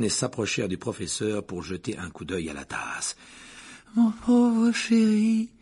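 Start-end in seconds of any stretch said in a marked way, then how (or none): 4.0-5.5 high, slow, tragic seer voice; tragic Trelawney. Acted. The divination teacher pities Harry, high and tragic. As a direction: lament it like a doom-laden prophet. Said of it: "au regard tragique".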